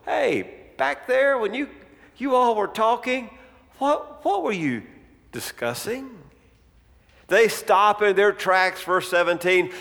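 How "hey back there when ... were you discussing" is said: feigned ignorance. Acted. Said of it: "Jesus asked as if he didn't already know".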